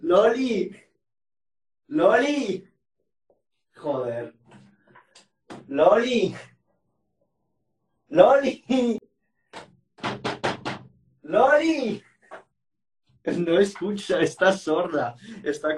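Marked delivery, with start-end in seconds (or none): 0.0-2.7 calling out. Real, not acted. Calling out to Loli. 5.7-12.0 calling out. Real, not acted. Calling out to Loli, louder.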